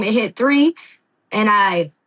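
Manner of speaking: normal talking voice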